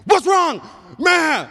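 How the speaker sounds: high, loud alarmed yell up at her; alarmed shout. Retold or imitated. Husband yelling up to his wife on the ladder, alarmed shout. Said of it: "and I'm like".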